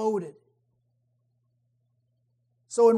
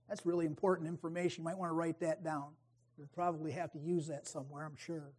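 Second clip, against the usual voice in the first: dry sarcasm; 4 semitones lower, 11 dB quieter, faster than usual. Acted. Sarcastic aside about baseball trivia.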